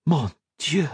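high, astonished French exclamation; exclaiming in wonder. A narrator's reading. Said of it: "Colette exclaimed".

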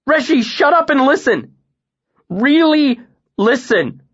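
high, loud command; desperate order to his master; urgent shout. A narrator's reading. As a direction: force him to listen. Bast yelling at Kvothe.

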